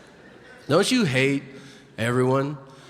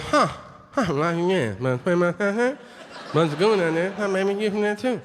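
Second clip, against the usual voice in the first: put-on higher, laughing mumble in a thick Cajun accent; mumbled Cajun accent; 4 semitones higher than usual. Comic mocking a Cajun fan after a show, garbled Cajun accent. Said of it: "And he goes".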